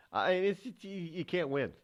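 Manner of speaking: ordinary delivery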